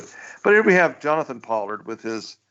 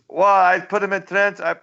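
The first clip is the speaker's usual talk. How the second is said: cartoon Pol Pot voice; 8 semitones higher, 4 dB louder than usual. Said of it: "He says".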